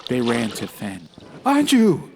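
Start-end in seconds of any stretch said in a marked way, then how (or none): 1.4-2.1 louder, sharp call of her name; yelled. Acted. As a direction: shout her name in relief. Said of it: "yelled Fen".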